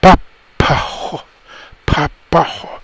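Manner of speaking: put-on slow papajo in a Castilian Spanish accent; Castilian accent, softly imitated. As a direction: mimic a Spaniard saying papajo. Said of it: "he's gonna say".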